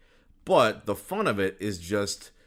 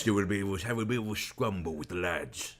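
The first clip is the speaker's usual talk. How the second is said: a light mock Cockney; lightly voiced Cockney bloke; Cockney accent; 4 dB quieter than usual. Keeping up the podcast bit in a soft mock Cockney. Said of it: "that Cockney accent".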